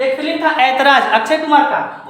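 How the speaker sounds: usual speaking voice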